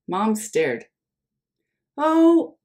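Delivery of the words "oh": dismayed oh. Acted. Mom reacts to Oliver.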